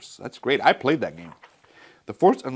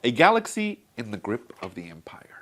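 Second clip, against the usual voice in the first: dramatic whisper; 4 dB louder than usual. Acted. Trailer-style dramatic opening.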